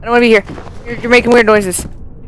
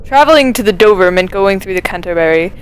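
reading aloud; 4 semitones lower than usual. Acted. Reads in-game note aloud.

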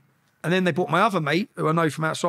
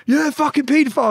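high, raised-voice jeer; retold heckling shout; 9 semitones higher, 4 dB louder than usual. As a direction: jeer it across the cells. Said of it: "I'm arguing".

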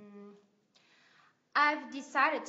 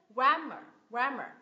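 GRAM in "Grammar" punched, ending falls away; first-syllable stress; 4 dB louder than usual. Shown as a demonstration. Teacher models Grammar for learners, first syllable stressed. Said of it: "Nhấn âm tiết thứ nhất".

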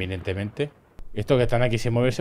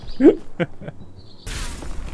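breathless laugh; 13 semitones higher than usual. Real, not acted. Laughing at a clip.

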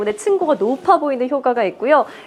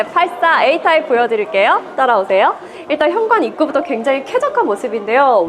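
usual speaking voice

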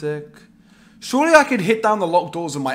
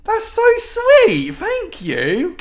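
mock delighted squeal; 7 dB louder, much slower than usual. Real, not acted.

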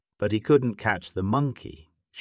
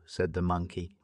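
plain reading voice

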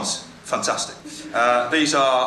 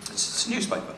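hushed aside giving the punchline; whispered answer; 8 semitones higher, 8 dB quieter than usual. Real, not acted. Host whispering the riddle answer to co-host, hushed.

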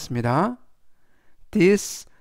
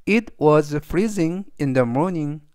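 very slow, deliberate example sentence; slow model reading; much slower than usual. Shown as a demonstration. Teacher reading the example aloud to students, slow and clear. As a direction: spell each word out for learners.

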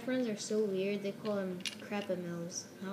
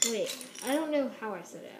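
ordinary talk, nothing marked

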